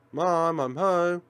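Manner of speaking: slow, loud call through the house. Acted. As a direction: call out to mom from the door. Calling out on arriving home.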